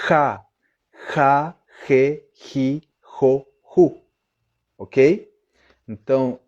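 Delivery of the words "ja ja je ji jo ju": ja je ji jo ju with a throaty h; Spanish J syllables. Shown as a demonstration. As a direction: drill the Spanish j, clear and deliberate. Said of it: "O som do J em espanhol é". (sílabas com j espanhol, aspirado)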